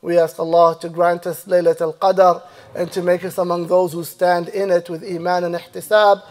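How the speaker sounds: ordinary talk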